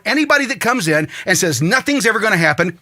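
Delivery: ordinary talk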